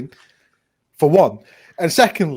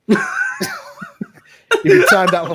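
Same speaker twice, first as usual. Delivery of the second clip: laughing; 3 dB louder than usual. Real, not acted. Laughing as he times out a friend.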